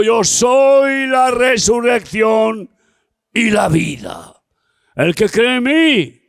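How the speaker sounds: high, loud, slow declaration; thundering proclamation. Acted. Preacher declaring Jesus' words, loud and high. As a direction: proclaim it as the climax of the sermon.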